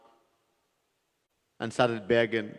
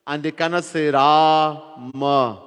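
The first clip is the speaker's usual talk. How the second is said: slow emphatic; slower than usual. Real, not acted. Preacher stresses the point.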